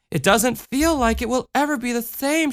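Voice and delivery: put-on high childlike voice, a touch of worry; girl worrying in Ma Marie's arms; worried little girl. A narrator's reading. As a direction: fret like a small girl. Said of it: "she worried".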